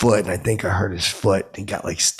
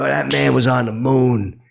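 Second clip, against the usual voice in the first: slow, loud mock yell, pitched up; retold yelling; 4 semitones higher, 5 dB louder than usual. Fan arguing with waiter about moon man, mock yelling. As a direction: insist loudly, stretching the words. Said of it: "I shouldn't have been yelling".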